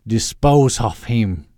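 slow, loud Austrian-tinged villain command; evil villain accent. Acted. Doctor Loeb demonstrating cool villain talk, evil accent. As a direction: pronounce it grandly in an evil accent. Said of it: "say something cool like".

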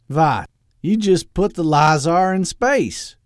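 put-on loud, high, slow Austrian-tinged villain accent; evil accent. Doctor Loeb questioning Edwin's laser plan, evil accent. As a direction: ask it in a hammy evil accent. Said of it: "talked in the evil accent".

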